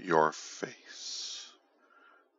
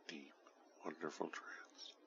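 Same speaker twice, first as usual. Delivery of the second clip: right in your ear; 15 dB quieter than usual. Acted. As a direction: close soft whisper. Whisper close to listener.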